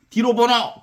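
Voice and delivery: acted roll-call shout; mock drill command. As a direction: call it out like ordering a line-up. Woodcutter orders children to count off.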